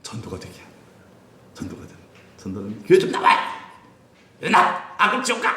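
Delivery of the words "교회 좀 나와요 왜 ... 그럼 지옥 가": pushy evangelist. Retold or imitated. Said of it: "이런 게 아니라".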